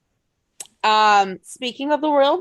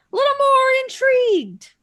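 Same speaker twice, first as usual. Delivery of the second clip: sarcastic; 6 dB louder than usual. Real, not acted. High sarcastic lift.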